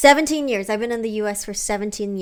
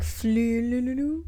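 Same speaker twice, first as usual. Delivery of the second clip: made-up word; 2 semitones higher, 3 dB quieter, much slower than usual. Pretend. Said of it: "sometimes I make up words".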